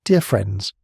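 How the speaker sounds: ordinary delivery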